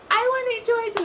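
very high, squeaky mock voice; mocking Nicole. Mocking Nicole announcing her HOH win. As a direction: chirp it like a smug winner. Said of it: "when Nicole's like".

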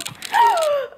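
sharp, high, hammed-up gasp; teasing fake gasp; mock gasp. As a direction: gasp like you've been caught. Mock shock during kiss request.

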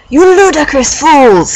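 high, slow mock-furious shout. A narrator's reading. Dumbledore scolding the couple, high drawn-out angry shout. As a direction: stretch it out in theatrical outrage. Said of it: "he shouted".

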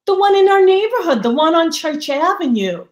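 high, loud and fast, tumbling out; eager answer. Retold or imitated. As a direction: rattle it off brightly as if it's obvious. Mother answers friend, rushed.

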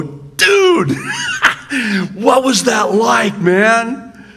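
high, loud, laughing buddy-style call; excited dude greeting. Retold or imitated. Greeting Gideon in heaven, amazed and laughing. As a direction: greet him like an old pal, amazed and laughing. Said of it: "I wanna go up to and go".

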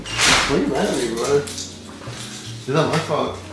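ordinary delivery

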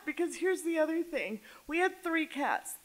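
ordinary delivery

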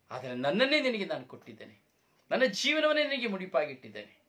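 loud, high, pleading cry of a hurt father; tearful pleading father. Acted. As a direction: beg your son with everything you gave him. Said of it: "ಅಳ್ತಾನೆ, ಕಣ್ಣೀರು ಸುರಿಸ್ತಾನೆ". (ಕಣ್ಣೀರಿನಿಂದ ಮಗನಿಗೆ ಜೋರಾಗಿ ಬೇಡುವ ತಂದೆ)

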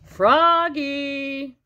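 high, drawn-out call; mom's singsong summons. Acted. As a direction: call from afar, stretching the name. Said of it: "called his mom".

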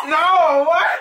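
high loud laughing protest; laughing disbelief. Real, not acted. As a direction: laugh out the shock. Wrong guess, laughing.

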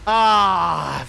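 ordinary talk, nothing marked

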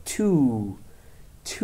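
TO with a long, stretched OO sound; drawn-out vowel. Shown as a demonstration. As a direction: hold the OO in TO, as a pronunciation model. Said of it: "باید کشیده تلفظ بکنیم". (تلفظ کشیده TO با «او»ی بلند)

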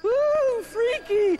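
high, loud mock-scared ooh; spooked kids. Kids teasing the priest about the god in the box, in a high squealing ooh. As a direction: squeal it like thrilled children. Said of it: "went the kids".

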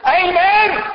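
very high, loud, held amen; hammed-up congregational shout; Pentecostal amen. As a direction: belt out an enthusiastic church amen. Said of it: "everybody would have said".

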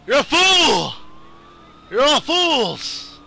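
high, drawn-out hammed-up cry; mock villain shout. Gamer denouncing the police officers, hammed-up shout. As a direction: cry it out melodramatically.

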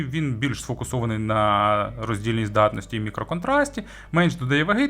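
normal talking voice

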